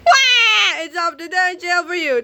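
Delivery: high, loud wailing cry; high loud cartoon sobbing. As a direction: wail like a tiny crybaby. Tiny nerd cries.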